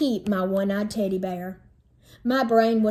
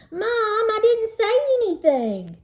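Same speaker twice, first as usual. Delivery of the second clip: much higher protesting screech; 11 semitones higher, 3 dB louder than usual. Acted. Sister screeching at mother, outraged. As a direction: shriek the protest like an outraged kid. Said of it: "Zipper Nose screeched".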